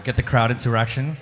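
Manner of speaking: normal talking voice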